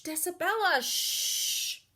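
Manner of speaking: a hint of a hushing adult; lightly voiced scold, half whispered; hushing grown-up. Grown-up scolding loud girl.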